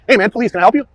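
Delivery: speaking loud. Retold or imitated. Officer shows how he announced himself, speaking loud. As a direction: project it clearly so he hears. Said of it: "I was speaking loud".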